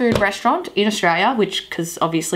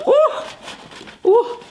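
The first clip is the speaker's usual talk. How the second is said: delighted high ooh. Real, not acted. Reacting to smelling chips.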